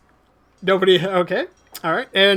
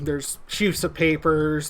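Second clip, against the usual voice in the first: normal talking voice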